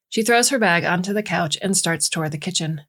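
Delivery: ordinary delivery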